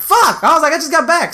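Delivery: high-pitched exasperated retelling; re-enacted frustrated whine; retold exasperation. Retold dismay at being called back.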